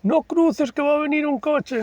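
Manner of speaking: high, anxious mother calling after her son; mother calling after her runaway son. Retold or imitated. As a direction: call him back like a worried mom. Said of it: "mi madre detrás".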